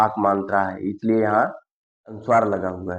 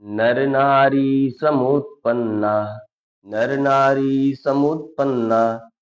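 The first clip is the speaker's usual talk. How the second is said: slow, loud chanting recitation of the shloka; Sanskrit verse recitation; 4 semitones higher, 4 dB louder, much slower than usual. Acted. Teacher reciting a Sanskrit shloka to students, slow and loud. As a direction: intone each line slowly and loudly, like a recitation.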